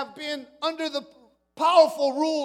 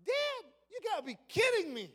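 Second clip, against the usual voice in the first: higher, pleading protest; disbelieving son; 5 semitones higher, 10 dB quieter than usual. Acted. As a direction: refuse to accept it.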